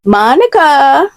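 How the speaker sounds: drawn-out singsong greeting; lilting, stretched call of a name; sing-songy. A narrator's reading. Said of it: "in his usual sing-songy voice".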